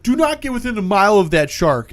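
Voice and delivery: mock urgent order. Warning the helicopter off the shark, mock urgent order. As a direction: give a hammed-up command over the radio.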